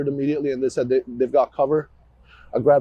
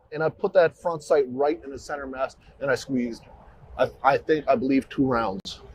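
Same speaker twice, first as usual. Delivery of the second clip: usual speaking voice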